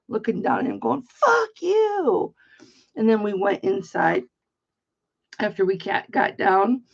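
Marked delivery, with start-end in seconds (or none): 1.0-2.3 high, loud retold curse flung downward; furious yell. Stuck on the ski lift, cursing at the laughing skiers below, high furious yell.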